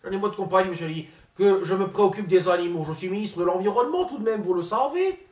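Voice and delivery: pompous politician impersonation; Ségolène Royal impression. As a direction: play the self-important minister, lofty and indignant.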